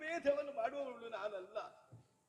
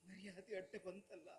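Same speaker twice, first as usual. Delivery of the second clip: lower, much softer grieving voice; grieving stepmother consoling her daughter; tearful; 4 semitones lower, 6 dB quieter than usual. Acted. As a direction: speak through held-back tears.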